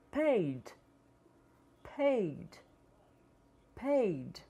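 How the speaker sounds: paid ending in a light d; final d. Shown as a demonstration. Teacher models paid with final d. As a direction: close paid with a soft d. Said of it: "Iremos pronunciar".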